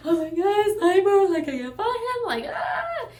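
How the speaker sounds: high, loud crying shout breaking into a wail; panicked crying. Retold or imitated. On FaceTime with her sister, high crying panic. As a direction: near tears, shouting it out in a rush of panic.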